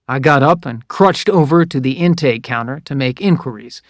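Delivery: ordinary delivery, nothing marked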